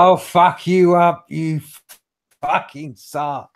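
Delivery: slow, high shouted threat; drawn-out yell from a window; screaming threat. Acted. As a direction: yell it out, dragging the words. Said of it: "Hector's just screaming out the window".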